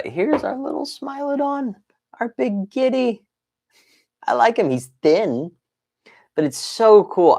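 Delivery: very high, cutesy pet-talk voice; cooing at kitty. Pretend. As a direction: coo over it like a tiny pet. Cooing over his cat figure.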